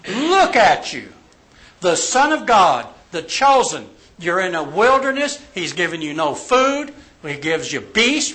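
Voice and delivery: emphatic, stretched challenge with punched words; taunting tempter. Acted. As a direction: goad the listener, leaning hard on key words. Preacher voicing Satan's taunting temptation.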